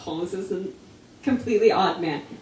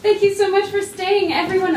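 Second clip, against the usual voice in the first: excited greeting; 9 semitones higher, 6 dB louder than usual. Real, not acted. Greeting the bookstore crowd.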